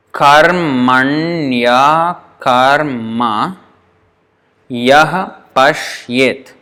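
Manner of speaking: "karmanya karma yah pashyet" drawn out syllable by syllable; slow, joined form. Shown as a demonstration. Teacher counting eight syllables, slow with joined ya. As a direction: stretch "karmanya" with i and a merged into ya. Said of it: "if we treat it as one word".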